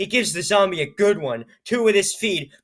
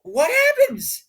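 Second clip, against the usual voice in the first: much higher, squealing mock scream; slower than usual. Reader turning to the scream page, squealing. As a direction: shriek the question in play. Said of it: "makes me scream more and louder".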